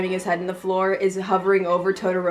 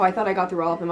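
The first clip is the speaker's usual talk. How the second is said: ordinary delivery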